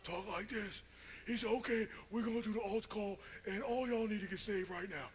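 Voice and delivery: lightly voiced big preacher, pitched high; a hint of a booming altar call.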